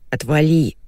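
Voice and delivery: ordinary delivery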